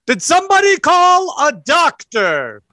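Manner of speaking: high-pitched, theatrical shouted entrance; very loud shout. Acted. Woman in lab coat walks into theater, shouting very loudly. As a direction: shout it out as a grand arrival. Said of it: "shouts very loudly".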